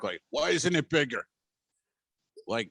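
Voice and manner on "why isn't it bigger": put-on annoyed New York accent, a bit higher; imitating a grumpy New Yorker's complaint. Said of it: "kinda New York".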